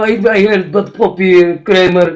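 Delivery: Russian comedy-goer impression; thick Russian-accented English; mock Russian accent. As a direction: put on a comic Russian accent. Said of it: "especially if you're Russian".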